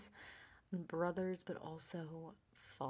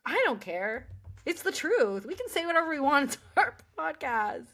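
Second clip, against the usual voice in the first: higher and louder, brushing it off; defiant; 7 semitones higher, 7 dB louder than usual. Real, not acted. Host to cohost, loud, defiant and giddy. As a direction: laugh off the worry, loud.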